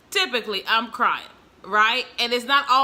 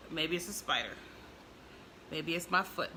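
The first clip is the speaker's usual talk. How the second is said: much softer, wondering to herself; quiet musing; 4 semitones lower, 14 dB quieter than usual. Retold or imitated. Watching the alert cat, softly guessing aloud. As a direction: murmur it like private guessing. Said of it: "I'm like".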